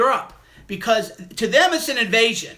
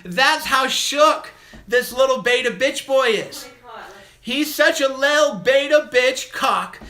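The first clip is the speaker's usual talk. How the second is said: loud, high taunting gloat; triumphant jeering at the caller who keeps redialling; 4 semitones higher, 4 dB louder than usual. Real, not acted. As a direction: crow loudly over the caller. Caller keeps hanging up, host gloats.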